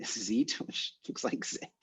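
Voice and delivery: words dissolving into quiet laughter; amused, breaking up. Real, not acted. Noticing the word looks like Zit, laughing through it. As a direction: lose the end of it to giggles.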